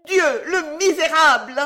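high, loud exclamation of outrage; outraged cry. A narrator's reading. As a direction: cry out in scandalised horror. Outraged mistresses cry out at intruder.

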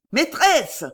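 high, loud call; crying out. A narrator's reading. Fat boy hailing his old mistress, crying out. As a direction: call out sharply to get her attention. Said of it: "cria le gros joufflu".

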